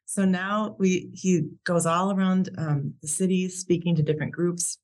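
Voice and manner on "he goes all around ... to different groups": ordinary talk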